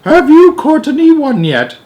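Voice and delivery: put-on very high, loud, icy old nanny. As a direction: freeze him with every word. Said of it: "in an icy voice".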